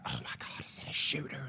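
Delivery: hushed, mostly voiceless mock panic; alarmed whisper. Wife grabbing husband in the theater, alarmed whisper. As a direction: imitate a frightened, whispered question.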